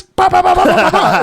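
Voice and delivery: high-pitched mock punch noises; rapid "bah" punches in a high voice; Mero. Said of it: "Mero goes".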